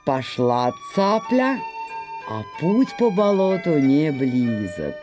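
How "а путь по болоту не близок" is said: ordinary delivery, nothing marked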